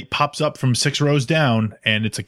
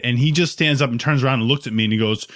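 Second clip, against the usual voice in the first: ordinary delivery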